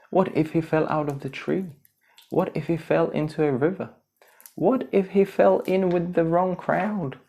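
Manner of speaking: fast, high fretting; high, quick anxious questions; worried. Acted. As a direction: rattle off fears in a panic. Timon and Pumbaa fear the worst.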